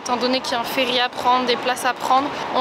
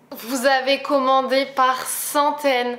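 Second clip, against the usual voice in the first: slow amazement; much slower than usual. Real, not acted. Émerveillée par les commandes.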